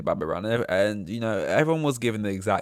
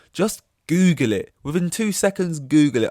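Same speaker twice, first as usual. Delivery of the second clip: exasperated; 6 semitones higher, 7 dB louder than usual. Real, not acted. Exasperated instruction.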